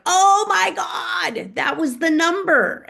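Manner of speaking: high, loud, crying-out "oh my God" moment; sudden realization. Retold or imitated. Retelling her breakthrough to the host, shouting in realization. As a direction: blurt it out as it all clicks. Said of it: "I was like".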